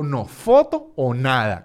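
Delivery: slow, deliberate "foto o nada" with a long drawn "foto"; mock threat, each word spaced out; threatening ultimatum. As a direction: lay down the ultimatum slowly. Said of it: "casi que amenazando".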